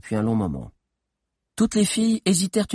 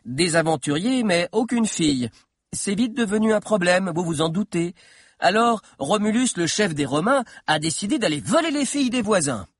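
put-on lightly raised boyish storytelling voice; eager schoolboy lecturer, a little higher; young historian telling his tale; 7 semitones higher than usual. A narrator's reading. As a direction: carry the listeners along, landing the last word. Figure of Valère telling the story.

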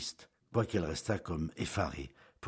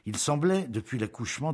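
ordinary delivery, nothing marked

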